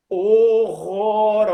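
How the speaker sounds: "horrorosas" stretched out loud, syllable by syllable; emphatic disgust. Real, not acted. Judging his own teenage lyrics, emphatically drawn out. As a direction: draw out "horrorosas" with huge emphasis.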